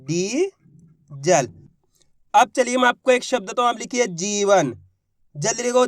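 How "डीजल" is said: very slow, stretched syllables; drawn-out word. Shown as a demonstration. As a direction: draw the word out so each letter is heard. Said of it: "तो मिलके बन गया अपने". (शब्द को धीरे-धीरे खींचकर बोलना)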